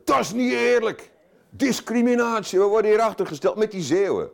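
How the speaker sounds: imitating indignant Dutch citizens; mock outrage. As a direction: complain like the wronged rest of the country. Rest of country protesting.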